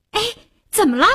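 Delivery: keen, lifted "what's up" tone; excited question. Acted. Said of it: "艾丽兴奋地问他".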